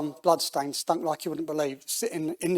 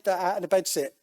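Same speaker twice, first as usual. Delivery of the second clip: plain reading voice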